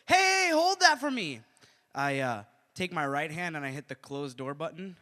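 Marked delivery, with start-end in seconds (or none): 0.1-1.4 high, loud, drawn-out call; stretched mock holler. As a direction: shout down the hall to hold the elevator. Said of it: "I hear someone go".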